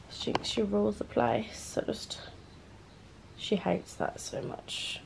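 near whisper about the cat; hushed murmur. Real, not acted. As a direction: keep it breathy and hushed so the cat isn't disturbed. Murmuring about her cat on bed.

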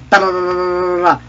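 rolled rat-a-tat; mock gunfire noise. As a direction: trill a pretend burst of fire. Imitates gunfire while playing.

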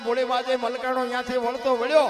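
loud, rhythmic chanted recital of a ballad; fiery dayro-style recitation of a village poem; folk poem recitation. Acted. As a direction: declaim each alliterative line in a driving rhythm, rising to a shout at the end. Recites Bhikhabhai Rabari's earthquake poem.

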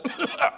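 put-on cough with a laugh; fake cough. [name] faking a cold, hammed-up cough. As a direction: cough to cover your voice. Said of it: "I got a cold".

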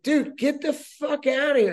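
retold furious get out; angry dismissal. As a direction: shoo the guy off, fed up. Telling the thief to leave.

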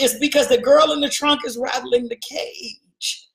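ordinary talk

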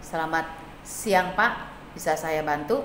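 low, soft monotone phone greeting; flat, unenthusiastic. Shown as a demonstration. Operator answering the phone datar, flat and unenthusiastic. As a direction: greet the caller with no enthusiasm at all. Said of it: "Kalau kita menerima telepon dengan datar".

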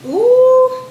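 high, loud, held "ooh"; excited "ooh". Real, not acted. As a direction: let out a long, rising whoop of delight. Excited reaction while cooking.

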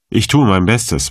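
ordinary delivery, nothing marked

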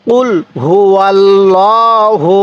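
কুল হু আল্লাহু slowly, each word apart; slow careful Quranic recitation; correct recitation. Shown as a demonstration. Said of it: "তাহলে কিভাবে পড়ব?".

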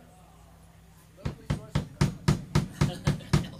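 loud, breathy burst of laughter. Real, not acted. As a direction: crack up out loud. Laughing at the plug.

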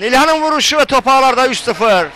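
loud goal shout; screaming football commentator. Real, not acted. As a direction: belt out the goal. Third goal called live.

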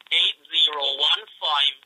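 slow and deliberate, each number set apart; slow dictation. Real, not acted. Man slowly dictating his address to the caller. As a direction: dictate the house number slowly, one piece at a time. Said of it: "what is your address?".

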